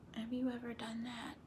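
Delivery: whispered question to the class. Real, not acted. Teacher whispering a question to her class. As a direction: lean in and whisper.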